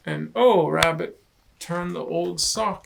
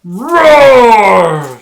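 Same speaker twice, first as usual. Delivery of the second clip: high, loud mock roar; Lion's roar; 6 semitones higher, 23 dB louder than usual. Lion roars at the shipwreck, loud mock roar. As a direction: roar it out playfully.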